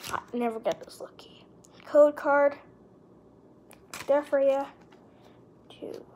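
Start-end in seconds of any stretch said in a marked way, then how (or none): 0.1-1.4 fast, breathless whisper. Real, not acted. Hushed aside during unboxing.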